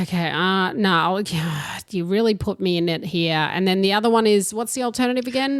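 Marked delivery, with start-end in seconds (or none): none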